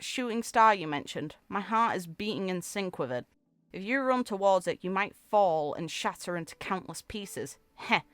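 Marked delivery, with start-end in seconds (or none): none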